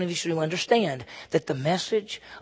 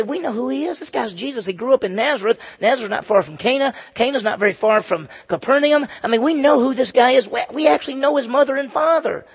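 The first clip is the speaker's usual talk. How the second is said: higher-pitched, insistent incredulity; indignant grumbling, pitched up; upset protest; 7 semitones higher, 4 dB louder than usual. Acted. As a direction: act out locals scoffing at someone they know. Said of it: "They're pretty upset".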